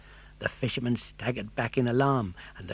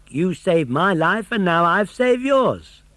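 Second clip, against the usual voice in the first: hearty dog; 6 semitones higher, 7 dB louder, slower than usual. A narrator's reading. Dog answering puppet's thanks.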